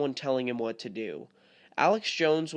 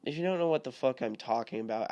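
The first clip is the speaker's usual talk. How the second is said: normal talking voice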